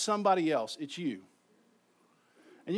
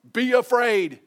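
booming "be afraid"; 7 semitones higher, 11 dB louder than usual. Pretend. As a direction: shout it loud and high. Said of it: "if I had been Samuel".

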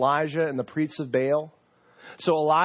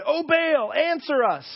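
high desperate call to a silent god; pleading cry; 11 semitones higher, 4 dB louder than usual. Retold or imitated. Priests begging their god on the mountain, high pleading cry. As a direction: cry out to Baal, pitch up high. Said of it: "And they said".

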